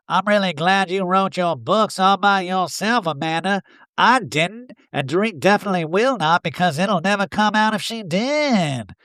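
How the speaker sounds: catty confessional. Retold or imitated. Eric in the Talking Head.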